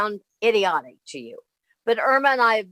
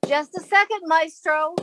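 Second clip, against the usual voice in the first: high, raised call through the door; 6 semitones higher than usual. Acted. Woman calling through the door to the maestro, high and raised. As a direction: sing out to the man knocking outside.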